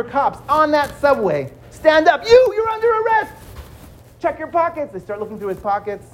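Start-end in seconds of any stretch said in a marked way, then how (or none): 2.3-4.9 high, commanding order; cop making arrest. Acted. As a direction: bark it like a cop.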